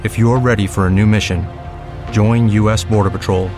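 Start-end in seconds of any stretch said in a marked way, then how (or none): none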